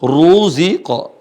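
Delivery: heavy Arabic ru; thick ru. Shown as a demonstration. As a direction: sound Ruziqa with a full, heavy ru. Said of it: "Ru dibaca tebal".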